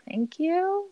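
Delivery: high, sardonic thanks; a dry, mock-grateful thank you; sarcastic thank you.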